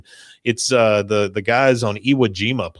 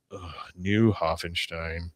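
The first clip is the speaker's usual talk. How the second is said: softer, slow weary groan; mock groan; 4 semitones lower, 10 dB quieter, slower than usual. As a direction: drag it out like it hurts. Hank dreading the town.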